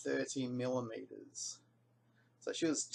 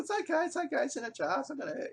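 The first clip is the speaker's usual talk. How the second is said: calming her; 13 semitones higher, faster than usual. Retold or imitated. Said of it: "I said".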